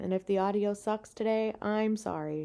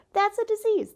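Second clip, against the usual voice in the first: much higher and louder; emphatic repeat; 12 semitones higher, 4 dB louder than usual. Retold or imitated. Scolding her own brain, much higher and louder. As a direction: blurt it out, scandalized.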